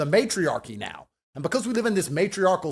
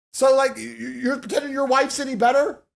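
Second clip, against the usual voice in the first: higher, louder shouted challenge; challenging shout; 6 semitones higher, 3 dB louder than usual. Real, not acted. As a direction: yell the dare at the viewers.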